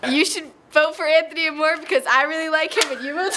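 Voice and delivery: loud, high shout. Acted. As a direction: shout the plug out. Shouted campaign plug.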